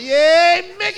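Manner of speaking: high, loud mock-gleeful cheer; kids cheering. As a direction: cheer it like an overexcited child. Kids cheering while beating Mickey.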